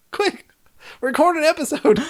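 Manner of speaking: excited. Pretend. Mock panic about email.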